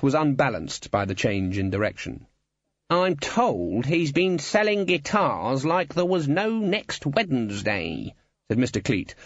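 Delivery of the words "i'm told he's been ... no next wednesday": high, slow, swooping voice; insinuating guild boss. A narrator's reading. Guild boss complaining of guitar sales, insinuating. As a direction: stretch the words with disapproval.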